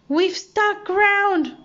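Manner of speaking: high and drawn-out, slow alarmed cry. A narrator's reading. Monkey cries out as the ship runs aground, slow and alarmed. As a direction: stretch it out like the ship just hit. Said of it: "cried Chee-Chee".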